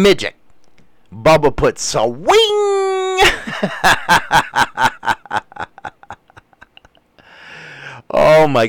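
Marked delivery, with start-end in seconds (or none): 2.3-5.9 high burst of real laughter. Real, not acted. Laughing at a chat joke, high pitched. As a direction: crack up at the joke.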